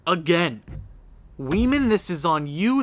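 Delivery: ordinary talk, nothing marked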